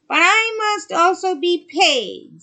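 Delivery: high, loud, slow witch voice; sea witch voice. Acted. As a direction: loom over her as the sea witch. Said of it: "said the witch".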